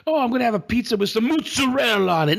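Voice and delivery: high, hammed-up Italian "mozzarella"; mock Italian accent. Mocking Americans who fake accents, hammed-up Italian. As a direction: overdo the Italian vowels like a poser. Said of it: "people were like".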